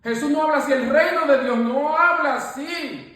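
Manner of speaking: high, loud preaching, hammering each line; fiery preaching. Acted. Rebuking doubtful talk before the prayer group, loud fiery preaching. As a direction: preach it, driving every phrase home.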